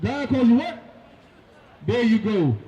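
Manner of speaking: ordinary talk, nothing marked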